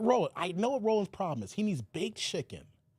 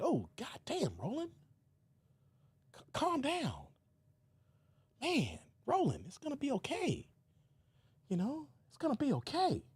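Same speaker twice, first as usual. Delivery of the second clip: much softer, consoling; soothing Roland; 6 semitones higher, 6 dB quieter than usual. Retold or imitated. Host consoling Roland over lost checks, soft. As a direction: hush him like a child.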